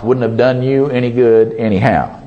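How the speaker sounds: ordinary talk, nothing marked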